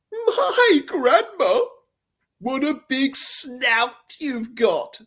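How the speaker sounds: high, slow, mocking little girl; rude brat. The brat taunting Grandma about her snout. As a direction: drag it out rudely like a cheeky kid. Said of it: "said the brat rudely".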